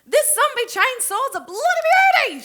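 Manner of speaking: loud, high shout of delight; elated old woman's yell; gleeful cry. Acted. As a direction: crow with glee over a gory game. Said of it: "she laughs".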